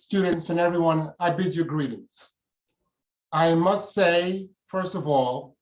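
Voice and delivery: ordinary talk, nothing marked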